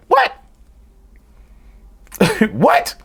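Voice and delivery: high rising disbelieving what; amused disbelief; incredulous. Real, not acted. Mock disbelief at the phrase.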